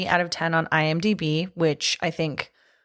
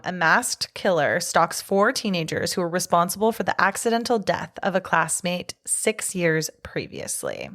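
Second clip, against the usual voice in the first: ordinary talk, nothing marked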